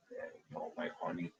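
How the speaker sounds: hushed, breathy words to a pet; soft to the dog. Real, not acted. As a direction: soothe the dog in a whisper. Soothing the dog during noise.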